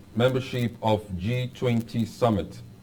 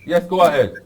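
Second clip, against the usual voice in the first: to someone hard of hearing; 7 semitones higher, 9 dB louder than usual. Real, not acted. Host calls to faint caller.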